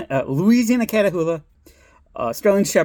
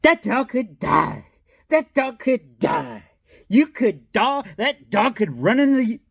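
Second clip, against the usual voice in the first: slow, higher scolding voice; preachy lady; 6 semitones higher, slower than usual. Retold or imitated. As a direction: lecture like a fussy stranger. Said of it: "she goes".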